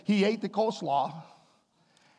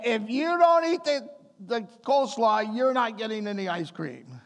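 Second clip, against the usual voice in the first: higher, firm mock warning from mom; stern mom; 3 semitones higher than usual. Mother to her five-year-old about the coleslaw, firm higher mock warning. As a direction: lay down the rule like a strict mother. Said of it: "No, she said".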